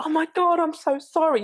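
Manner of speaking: high, gushing mock apology; mock sorry puncher. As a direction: squeak out a flustered sorry. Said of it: "You're like".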